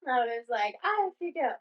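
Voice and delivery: reliving the frantic search, a bit teary; tearful panic. Retold or imitated. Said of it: "I started crying".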